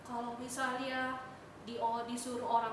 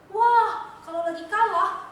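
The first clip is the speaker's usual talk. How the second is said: excited exclaim; 7 semitones higher, 12 dB louder than usual. Acted. Describing angry gamers.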